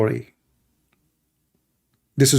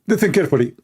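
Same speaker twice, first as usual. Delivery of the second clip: usual speaking voice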